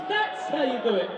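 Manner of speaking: ordinary talk, nothing marked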